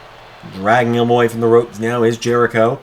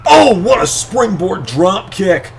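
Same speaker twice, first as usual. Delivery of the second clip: excited call; 6 semitones higher, 3 dB louder, much slower than usual. Real, not acted. Big move in live commentary.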